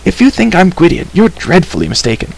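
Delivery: high protest, settling by the end; defensive retort shouted back; shouting back. A narrator's reading. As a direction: start high and indignant, then drop. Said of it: "the stranger shouted back".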